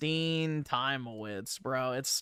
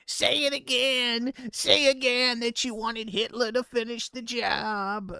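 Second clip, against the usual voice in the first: mocking confrontational heckler; 9 semitones higher, 3 dB louder than usual. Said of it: "who were, like, going".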